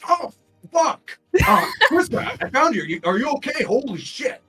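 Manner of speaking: high, loud, frantic blurting; excitable Hugh. Acted. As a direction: burst out in a panicky, over-excited high voice. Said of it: "out of a bush jumps Hugh".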